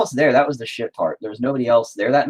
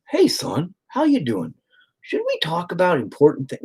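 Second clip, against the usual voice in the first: higher-pitched sing-song mock greeting; mock casual fatherly chat; 8 semitones higher than usual. Father pretending to chat with injured son, mock casual.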